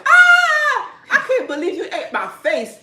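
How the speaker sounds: high shrieking fish voice, laughing through it; screaming fish. Retold or imitated. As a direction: shriek like a fish being eaten, cracking up. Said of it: "I heard the fish screaming".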